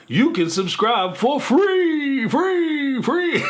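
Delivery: hammed-up infomercial voice, rising to a high shouted "free"; mock ad pitch. Mock limited time subscribe offer.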